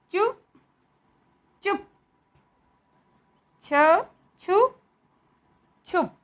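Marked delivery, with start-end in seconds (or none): none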